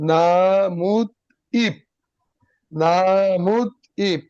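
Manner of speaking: নামুত ইপ said very slowly, syllable by syllable, as written; slow spelled-out reading. Shown as a demonstration. Teacher shows students the spelled form, very slowly. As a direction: drag out নামুত ইপ so each sound is heard. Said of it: "দেখ খেয়াল কর".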